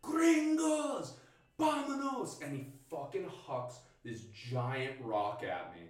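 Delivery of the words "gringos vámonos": high, loud yell, long drawn-out word; imitated attackers' scream. Mountain attackers yelling at foreign campers, imitated scream. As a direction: yell like an angry pursuer charging in. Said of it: "they start screaming".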